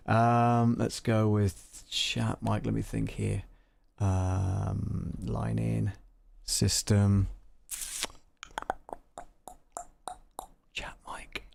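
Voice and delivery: softly reading options to oneself; thinking aloud. Real, not acted. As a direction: drop the volume, as if working it out privately.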